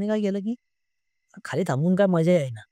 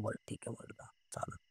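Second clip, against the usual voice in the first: very soft, low murmur; hushed, low-pitched okay; retold quiet agreement; 7 semitones lower, 22 dB quieter than usual. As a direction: barely voice the reply, as if conspiring.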